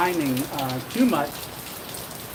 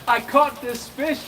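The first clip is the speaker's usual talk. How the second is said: ordinary delivery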